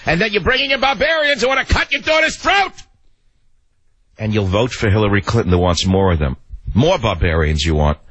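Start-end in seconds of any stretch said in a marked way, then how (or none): 0.1-2.7 a real shout, very high and strained; furious shout. Real, not acted. Host raging at listeners about barbarians, furious shout. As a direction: scream it out in full rage. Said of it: "I went from compassion to rage".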